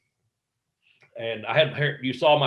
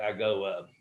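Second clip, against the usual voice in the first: ordinary delivery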